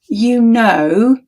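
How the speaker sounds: ordinary delivery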